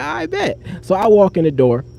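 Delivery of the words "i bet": high mock agreement. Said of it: "So I'm like".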